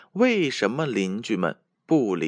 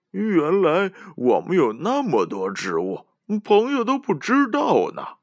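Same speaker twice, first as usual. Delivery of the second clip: high, loud, giggly little elephant; laughing elephant voice; 10 semitones higher, 4 dB louder than usual. A narrator's reading. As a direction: chuckle it out as a child. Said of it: "小象笑呵呵地说".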